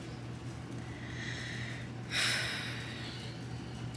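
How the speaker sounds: long, soft breathy sigh. Real, not acted. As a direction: let out a drawn-out sigh over a small disaster. Sighs over the ruined coffee.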